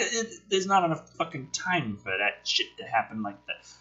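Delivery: usual speaking voice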